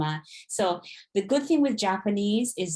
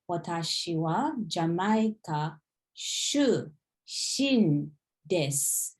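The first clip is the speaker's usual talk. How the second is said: slow careful; much slower than usual. Shown as a demonstration.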